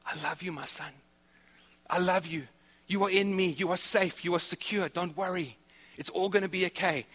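a light touch of God's soothing voice; reassuring God voice. Retold or imitated. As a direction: comfort a child softly. God speaking comfort to believer.

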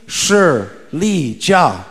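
"Shi Li Jia" with a pause between syllables; Chinese pronunciation. Shown as a demonstration. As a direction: lead a group repeating "Shi Li Jia". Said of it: "Can you say that with me?".

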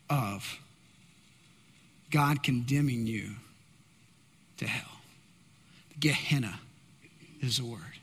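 grave low warning; solemn hush. Real, not acted. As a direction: let it sink to almost nothing.

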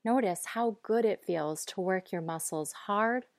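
ordinary talk

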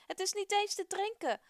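loud, high furious complaint; angry shout. Acted. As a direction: keep yelling at Moses. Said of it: "schreeuwt een boze man".

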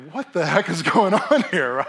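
acted-out bewildered outburst; incredulous. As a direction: stretch it out, loudly baffled. Imagined diner's shocked reaction.

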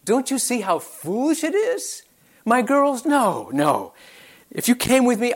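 earnest plea, dropping on the refusals; Naomi. Acted. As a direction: plead with them to see sense. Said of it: "she is saying".

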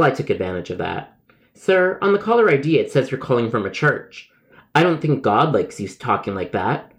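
ordinary delivery

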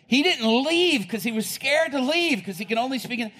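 usual speaking voice

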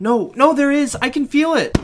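very high, loud panicked pleading; a kid desperate to be believed; terrified child. A narrator's reading. Child insisting to dad about the monster.

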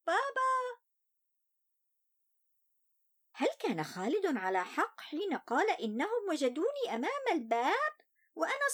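put-on child voice. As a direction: tell it as an upset little boy. First-person child narrator Ali.

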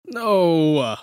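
drawn-out loud refusal; loud protest. Real, not acted. Host refusing the next impression, loud protest. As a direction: refuse loudly, stretching the word.

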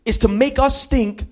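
building gospel preaching. Acted. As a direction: preach it with rising fire. Preaching at full force.

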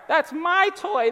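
put-on high, drawn-out childish whine; whining child. Dad imitating his kids over a toy, whining. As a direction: whine possessively like a little kid. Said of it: "when they whine".

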